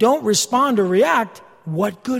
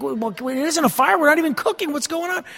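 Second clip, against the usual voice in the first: mock panic; 7 semitones higher than usual. Retold panic over false alarm.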